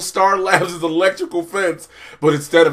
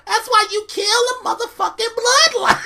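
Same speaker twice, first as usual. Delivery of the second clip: very high squawking mock outrage; villain screech. Queen of Fables scolds the crew, high screeching outrage.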